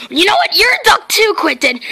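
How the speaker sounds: ordinary talk, nothing marked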